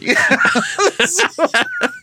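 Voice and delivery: a bright laugh jumping way up; real amused cackle; laughing high and loose. Real, not acted. Laughs at own joke.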